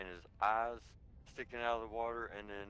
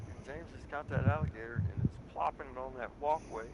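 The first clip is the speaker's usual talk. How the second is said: usual speaking voice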